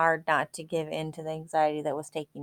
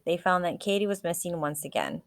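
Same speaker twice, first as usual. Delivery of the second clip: ordinary delivery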